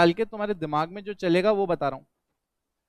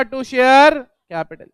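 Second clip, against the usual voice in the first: "To share capital" drawn out word by word, loud; slow dictation; 5 semitones higher, 9 dB louder, much slower than usual. Shown as a demonstration. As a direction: spell out the entry slowly for the class. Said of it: "dictating entry slowly". (धीरे-धीरे बोलकर लिखवाना)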